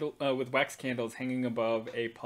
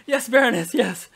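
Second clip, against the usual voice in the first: higher, louder eager servant; flustered servant; 9 semitones higher, 12 dB louder than usual. Acted. Servant rushing to her mistress, higher and louder. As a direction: answer quickly, eager to please. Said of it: "She goes".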